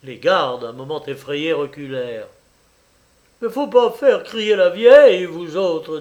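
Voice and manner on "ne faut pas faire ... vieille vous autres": loud, high, angry warning; butcher's roused, rough warning; hoarse threat. A narrator's reading. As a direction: warn the guards off forcefully. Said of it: "de sa voix enrouée".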